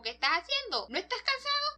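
high-pitched mock alarm; startled questions climbing sharply in pitch; frightened father. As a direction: imitate a scared, fussing dad. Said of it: "el papá está asustado mirándolo".

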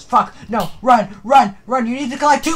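real panicked shouting. Real, not acted. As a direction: urge yourself to run. Fleeing the principal.

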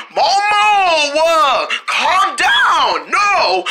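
high, drawn-out yells in a struggle; exasperated shouting. Acted. Calming a turtle who refuses, exasperated shouting. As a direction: holler over the commotion.

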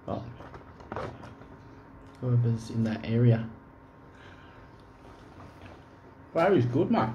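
chewing between words; talking while eating. Real, not acted. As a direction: mouth partly full. Seen 1: Eats throughout; picks fries and lifts one to mouth, jaw working.

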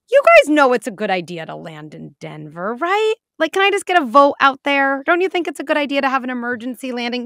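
loud, high pilot asking for a vote; wishy-washy pilot. Pretend. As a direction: poll the passengers nervously. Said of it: "a pilot coming on and being like".

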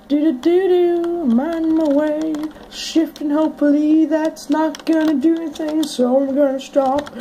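humming on his way; sing-song. Real, not acted. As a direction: hum along casually. Humming while walking.

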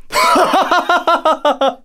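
high, theatrical villain cackle; villain laugh. Acted. Satan daring a challenger, high triumphant cackle. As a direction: cackle in a long, high, even burst.

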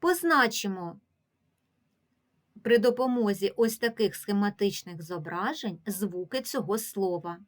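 normal talking voice

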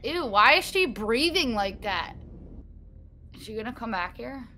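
ordinary talk

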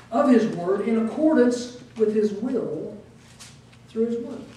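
ordinary talk

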